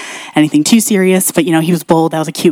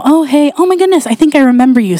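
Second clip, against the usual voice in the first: higher-pitched cheery recognition; excited greeting; 7 semitones higher than usual. Retold or imitated. Driver recognizes the checkout girl, excited greeting. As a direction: greet a half-remembered acquaintance brightly.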